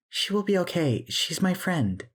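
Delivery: put-on very high little-girl voice; reassuring child. A narrator's reading. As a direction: comfort her in a small child's voice. Child Emily comforts Maggie.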